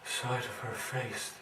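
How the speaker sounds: ordinary talk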